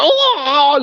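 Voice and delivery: high mocking moans; mock moaning. Taunting his opponent with imitated moans, hammed-up moaning. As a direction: moan to tease him. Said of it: "Look at you moan".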